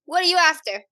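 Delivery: put-on high-pitched suspicious child; demanding orphan girl. Knife-wielding girl questioning the strangers, high and demanding.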